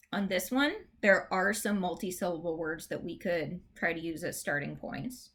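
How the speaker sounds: ordinary talk, nothing marked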